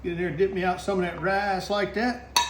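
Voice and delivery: normal talking voice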